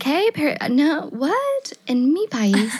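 put-on high, halting, thick American accent in Spanish; fake tourist accent. To the Barcelona cops, hammed-up American tourist accent in Spanish. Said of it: "up my accent by, like, a thousandfold".

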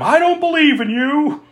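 high mock yell of defiance; mild mock defiant yell. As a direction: shout it at the monster. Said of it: "She yells at him".

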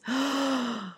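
1 shocked gasp. Shown as a demonstration. Shocked at dog kicking.

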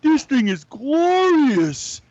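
high, slow, swooning delight; hammed-up adoring gasp over a toy; gushing collector voice. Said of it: "he was like".

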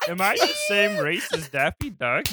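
comic spaceman impression; Marvin the Martian. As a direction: play a puzzled cartoon Martian. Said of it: "they kinda sound like Marvin the Martian".

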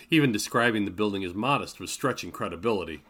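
ordinary delivery, nothing marked

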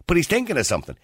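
ordinary talk

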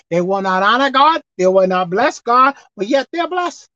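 ordinary talk

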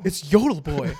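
loud, excited announcement. Retold or imitated. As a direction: say it like it's obvious. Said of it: "And I was like".

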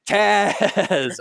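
high, loud, drawn-out name; hammed-up calling out. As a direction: belt out the name theatrically, stretched long.

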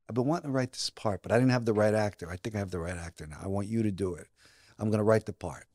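low, soft, confiding producer; quietly earnest retold pitch. Producer offers him the part.

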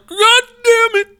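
very high, slow, loud hammed-up sob; mock crying. Imitating himself crying at the movie, hammed-up sob. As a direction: wail it out like you're about to cry.

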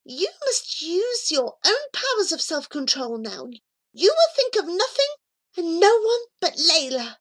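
high, commanding instruction; steady him with firm words; firm cat voice. Acted. Cat telling Abu to focus, high and firm.

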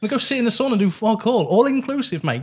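high, fast, fed-up pleading; exasperated mate. Retold or imitated. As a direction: whine it like an impatient mate. Friend demanding a lazy beach holiday.

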